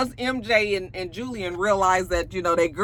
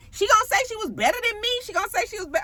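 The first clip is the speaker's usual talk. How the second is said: mock-offended, high and rapid; Suki; 14 semitones higher, much faster than usual. Vlogger imitating castmate hearing she was outranked, high mock indignation. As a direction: fire it off in high-pitched indignant disbelief. Acts offended Suki's disbelief.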